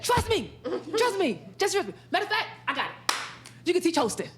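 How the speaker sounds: imitating a fast-talking Doctor James; fast talker. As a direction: talk him into it quickly. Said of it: "he talk real fast like that".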